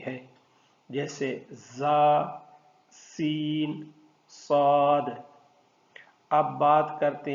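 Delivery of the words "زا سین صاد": زا، سین، صاد with a sharp hissing whistle; whistling letters. Shown as a demonstration. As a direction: let زا، سین، صاد whistle through the teeth. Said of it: "ان تین حروف میں سیٹی کی آواز". (سیٹی کی آواز کے ساتھ زا، سین، صاد)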